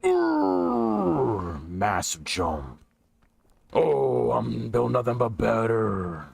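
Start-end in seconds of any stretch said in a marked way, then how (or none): 0.0-1.7 high strained grunt; effortful grunt. Real, not acted.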